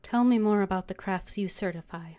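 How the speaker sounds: ordinary delivery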